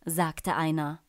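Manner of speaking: plain reading voice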